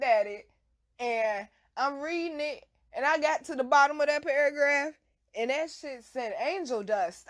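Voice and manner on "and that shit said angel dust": usual speaking voice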